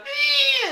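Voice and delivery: short, very high squeal; creature squeal. Retold or imitated. Creature squeals as tongues meet, high mock squeal. Creature noise in movie retelling.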